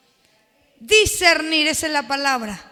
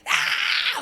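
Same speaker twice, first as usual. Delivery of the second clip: demon scream. Acted.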